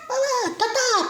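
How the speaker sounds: high-pitched small boy imitation; nephew's little voice. Little nephew calling his aunt, imitated high little voice. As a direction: put on a tiny child's voice. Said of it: "c'est sa petite voix. Il était petit".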